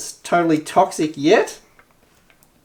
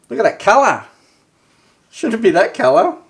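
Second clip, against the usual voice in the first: shocked; 8 semitones higher than usual. Real, not acted. Shocked at the can's contents.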